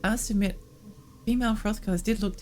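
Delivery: usual speaking voice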